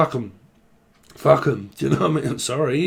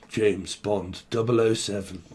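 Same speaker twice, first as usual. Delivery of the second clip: low, soft posh British voice; smooth posh English introduction, pitched down; posh James Bond; 4 semitones lower, 6 dB quieter than usual. Acted. Answering a request for a name, posh British spy. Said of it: "Speak very posh".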